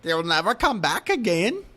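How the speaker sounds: creepy little Leprechaun warning. Retold or imitated. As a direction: croak it like the Leprechaun. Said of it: "That sounded like the Leprechaun".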